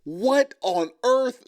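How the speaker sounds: slow, drawn-out shocked question; can't believe what she just heard; stunned disbelief. Real, not acted. Shocked by a couple's claim.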